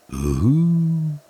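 high hooting wind howl. Acted. Wind howling through dark trees, high hoot. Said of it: "heult der Wind".

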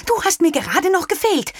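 mother fed up with the dog; exasperated mother. A narrator's reading. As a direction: throw up your hands at the dog. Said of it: "sagte Frau Moser".

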